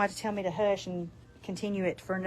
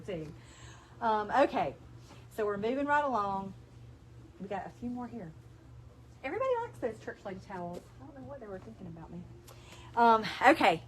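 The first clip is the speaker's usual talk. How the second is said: normal talking voice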